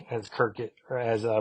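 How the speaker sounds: ordinary talk, nothing marked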